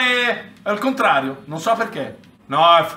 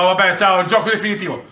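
usual speaking voice

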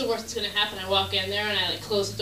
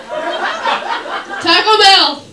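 high, loud, very slow Taco Bell with a laugh; stretched-out Taco Bell; 9 semitones higher, 12 dB louder, much slower than usual. Acted. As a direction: savor the name Taco Bell. Drawn-out comic emphasis.

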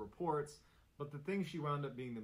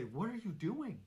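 higher-pitched startled question; a touch of alarm, pitched higher; lightly acted sleeper's question; 8 semitones higher, 3 dB louder than usual. A narrator's reading. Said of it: "hears herself sit up in the bed and say".

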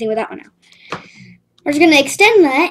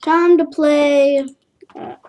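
ordinary talk, nothing marked

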